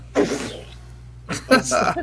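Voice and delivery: puke spewing out noise; imitated vomit spray. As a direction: mimic spitting vomit out in a burst. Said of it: "so I'm like".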